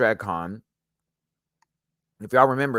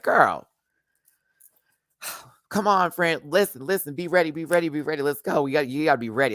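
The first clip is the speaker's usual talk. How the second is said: urging to hurry; 5 semitones higher than usual. Retold or imitated. Friend who didn't move fast enough, urged on eagerly. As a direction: hurry them up, pleading and pushing.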